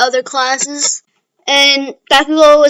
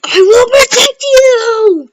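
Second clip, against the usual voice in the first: slow, much higher, louder shout; heroic shout; 10 semitones higher, 6 dB louder, much slower than usual. Retold or imitated. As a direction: bellow a promise to protect. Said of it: "But then Bakugo's like".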